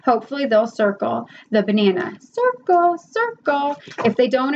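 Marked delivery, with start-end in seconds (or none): 2.2-3.8 teacher prompt. Shown as a demonstration. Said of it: "prompt demo".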